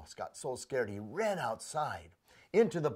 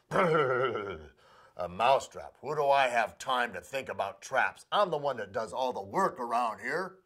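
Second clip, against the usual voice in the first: a tired worker who can't be bothered; grumpy workhorse; 6 dB louder than usual. Acted. Gruff horse dismisses mouse.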